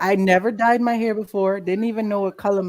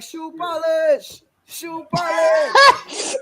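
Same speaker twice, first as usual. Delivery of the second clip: slow, high, sing-song teasing chant with a laugh; higher, drawn-out playground jeer; mocking taunt; 9 semitones higher, 4 dB louder, much slower than usual. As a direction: drag out the name like a schoolyard taunt. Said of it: "somebody's like".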